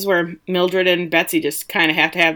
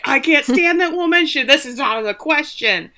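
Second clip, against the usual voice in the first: hammed-up indignation; indignant refusal; 7 semitones higher than usual. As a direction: protest in acted outrage, pitched way up. Acts out Hanover's indignant refusal.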